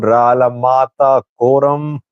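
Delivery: ordinary delivery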